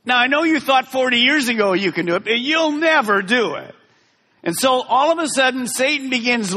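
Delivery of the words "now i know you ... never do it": Satan's lying voice. Acted. Satan taunting Joshua about the giants, mocking tone. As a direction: whisper doubt like a tempter. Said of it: "Satan could have been lying to Joshua".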